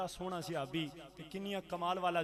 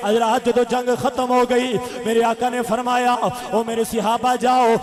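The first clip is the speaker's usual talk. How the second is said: loud, ringing sermon chant; full-force preaching; 8 semitones higher, 15 dB louder than usual. A narrator's reading. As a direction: preach the battle story at full volume. Preaches the battle story.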